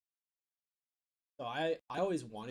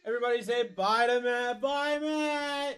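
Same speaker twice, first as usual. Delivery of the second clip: high, held-out calling voice; hyped send-off; 6 semitones higher than usual. Real, not acted. Host telling everybody to say bye to Matt, high calling send-off. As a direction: lead the crowd in a goodbye. Host leads goodbye to departing guest.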